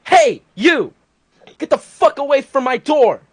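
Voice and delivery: loud angry yell from across the street; retold yell. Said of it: "a guy yells at me from across the street".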